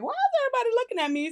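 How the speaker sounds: mock bewildered self; confused. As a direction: rush it out puzzled. Confused walking to phone.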